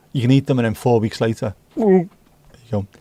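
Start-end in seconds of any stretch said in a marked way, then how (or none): none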